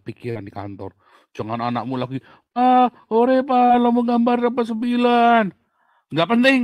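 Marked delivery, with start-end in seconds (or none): 2.5-5.5 put-on high, loud childish call to dad; imitating an eager child. Small child begs busy father to look at his drawing, high and loud. Child calling for dad's attention. 6.1-6.6 high, loud brush-off; dismissive dad. Retold or imitated. Busy father brushes off his child's drawing, loud and dismissive.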